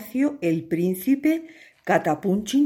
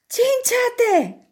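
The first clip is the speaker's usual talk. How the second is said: much higher, slow happy cry of his name; joyful call; 3 dB louder, slower than usual. Acted. Rescued princess calls her hero, joyful. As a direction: call him with delight.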